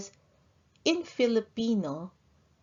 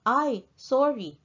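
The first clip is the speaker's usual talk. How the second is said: higher, louder "Ay, sorry" dropping at the end; startled apology; 6 semitones higher, 4 dB louder than usual. Acted. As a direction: apologize on the spot. Acted apology in dialogue example.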